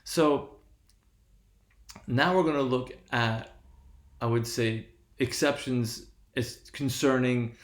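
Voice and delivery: normal talking voice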